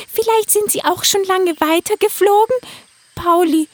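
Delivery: a little unicorn losing hope; worried girl. Acted. Unicorn girl voices worry.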